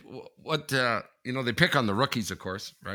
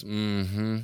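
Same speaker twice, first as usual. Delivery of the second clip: slow monotone hum of acknowledgement; disinterested mm-hmm. Retold or imitated. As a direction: mimic a listener who is barely engaged. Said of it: "They sound so disinterested".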